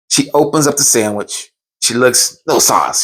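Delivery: ordinary talk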